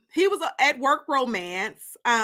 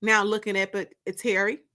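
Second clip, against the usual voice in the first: steady, lower reading voice for the book; story narration; 4 semitones lower than usual. Acted. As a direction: read the chapter like an audiobook narrator. Reading her novel aloud.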